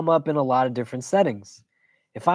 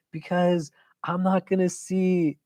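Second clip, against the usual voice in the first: little son's voice; 5 semitones higher than usual. Retold or imitated. Dad voices crying son.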